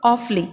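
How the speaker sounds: awfully as two syllables, AWF-lee; teacher's model "awf-lee"; demonstrated pronunciation. Shown as a demonstration. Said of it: "कैसे बोलेंगे".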